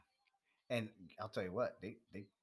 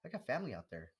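mock fake-friendly agent; 8 semitones higher, faster than usual. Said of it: "and like".

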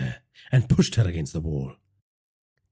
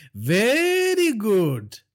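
high, very slow drawn very good; drawn-out villain; 3 dB louder, much slower than usual. Acted. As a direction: stretch very good out slowly. Villain drawls.

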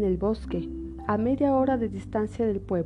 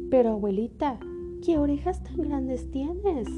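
put-on little girl voice; 5 semitones higher than usual. Caperucita pregunta, asombrada.

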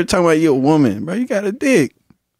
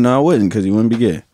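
normal talking voice

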